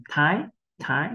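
"thái" with a puff of air on the T; aspirated T. Shown as a demonstration. Teacher showing the English T like Vietnamese th, aspirated "thái". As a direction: breathe out on the T of "thái". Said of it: "phụ âm T mình bật hơi".

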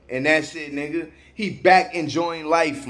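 ordinary talk

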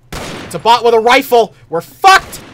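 loud high panic; panicked yell. Real, not acted. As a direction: shriek it in alarm. Bot with rifle appears.

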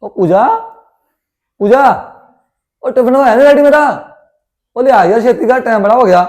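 calling out. Acted. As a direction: hurry her along, shouting for the tiffin. Son calling for his lunch.

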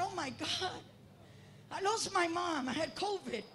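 usual speaking voice

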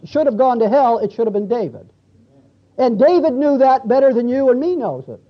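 ordinary talk, nothing marked

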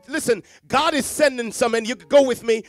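usual speaking voice

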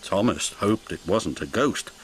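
ordinary delivery, nothing marked

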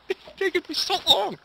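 high, laughing whine; laughing complaint. Real, not acted. Youtuber whining through laughter about retakes. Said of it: "I can't get this right".

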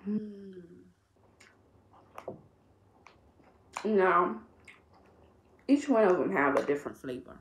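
talking around a gummy; chewing while talking. Real, not acted. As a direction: mouth partly full. Seen 2: Ate green gummy just before; jaw and lips chew between words.

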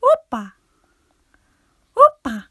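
loud, high, punchy Opa; sudden exuberant yell; celebratory shout. Pretend. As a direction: shout Opa like a party cheer. Shouts the word as a joke.

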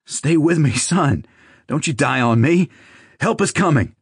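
high, urgent pleading; trembling plea. A narrator's reading. Father pleads with his dying son, trembling and urgent. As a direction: plead desperately, voice lifted high. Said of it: "His voice was trembling as he pleaded".

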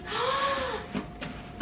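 long high gasp of amazement; amazed gasp. Real, not acted. Unboxing a subscriber's package, amazed gasp. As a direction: suck in breath in awe.

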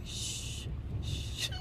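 soft, breath-only shush; retold shushing. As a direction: hush a noisy child quietly.

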